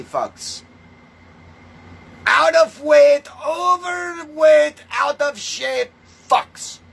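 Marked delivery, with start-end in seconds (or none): 2.3-6.8 high, loud, stretched-out insulting yell; furious shout. Real, not acted. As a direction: bellow the insult, dragging it out slowly. Insulting fat mullahs, yelling.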